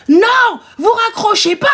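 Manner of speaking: high mock shout; angry caller. Client refuses to let the agent hang up, indignant. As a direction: cut in, imitating an irate client.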